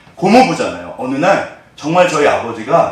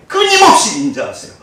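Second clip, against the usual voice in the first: ordinary talk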